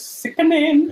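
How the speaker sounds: gushing. Real, not acted. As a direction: rave about it like it's stunning. Raving about the Joker cover.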